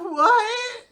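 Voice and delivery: very high, loud squeak; startled reaction. Retold or imitated. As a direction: squeak it out in shock. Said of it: "she's like".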